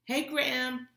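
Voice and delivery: high, loud twins' hello; calling greeting. A narrator's reading. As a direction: sing out a loud hello to grandma. Twins greet grandmother on speakerphone.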